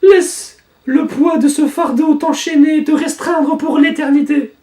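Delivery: high, slow, solemn curse; slow ominous villain. Acted. Villain sealing someone's fate, slow and high. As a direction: drag out each word like a dark spell. Villain line read aloud.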